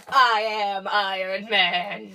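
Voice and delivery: slow, loud, grand declaration; hammed-up superhero announcement; dramatic movie-line quote. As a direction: stretch it out like a big hero reveal. Quotes the film's famous end line.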